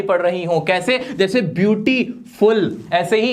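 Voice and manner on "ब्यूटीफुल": ब्यूटीफुल slowly divided as "beauty... ful"; several separate sounds. Shown as a demonstration. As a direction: pull ब्यूटीफुल apart before "ful". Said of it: "दो या दो से ज्यादा आवाजें". (ब्यूटीफुल धीरे, टुकड़ों में, "ब्यूटी... फुल")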